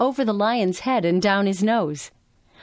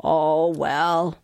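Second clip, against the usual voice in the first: lion's offhand shrug; lightly voiced dismissive lion; shrugging; 3 semitones lower than usual. A narrator's reading. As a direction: let it go with a verbal shrug. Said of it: "he shrugged".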